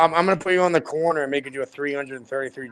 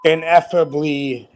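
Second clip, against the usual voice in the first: slow and deliberate; much slower than usual. Shown as a demonstration. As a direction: draw the big word out slowly. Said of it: "slow showing off a big word".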